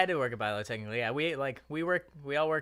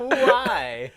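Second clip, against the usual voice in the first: high, louder echoed why; mock incredulous echo; 9 semitones higher, 9 dB louder than usual. Friend echoing the customer's line, mock disbelief. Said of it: "has the audacity to go".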